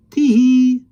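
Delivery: high, loud little tee-hee; shy giggle. Acted. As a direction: giggle bashfully. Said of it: "giggled shyly".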